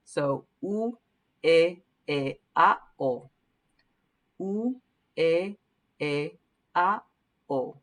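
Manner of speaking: the vowels of "supermercado" (u, e, e, a, o), each pure and separate; isolated clean vowels for students; Spanish vowels. Shown as a demonstration. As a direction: sing out u, e, e, a, o one by one. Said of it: "taking out the vowels again".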